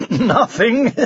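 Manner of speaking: laughing it off, high and light; happy laugh. A narrator's reading. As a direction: laugh through the word Nothing. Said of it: "said Sir Tumbleweed happily".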